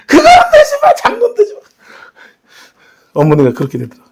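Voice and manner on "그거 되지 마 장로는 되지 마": very high, urgent squawk; hammed-up frantic insistence; mock forbidding mother. Acts out mother forbidding eldership.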